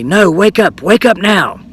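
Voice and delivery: high, insistent mock yell without much volume; mock persistent scream. Old man keeps at the sleeping woman, insistent mock scream. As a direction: imitate someone screaming to rouse a sleeper. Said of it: "keeps screaming at her very persistently".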